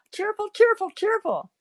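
high, light mock-cheery singsong; put-on cheerful chirping. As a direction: chirp it high, making fun of your own cheer. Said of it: "in my most cheerful voice".

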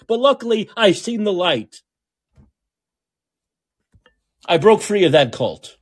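venting real anger, voice climbing; getting mad. Real, not acted. Ranting about a politician, voice climbing in real anger. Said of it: "I'm getting mad".

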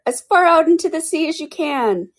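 high excited shout. A narrator's reading. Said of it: "shouted the Griffin".

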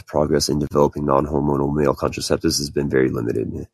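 ordinary talk

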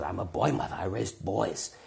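put-on German-accented wolf mother; old German woman. Old woman tells of raising sons, German accent. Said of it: "it's like she's a wolf mother".

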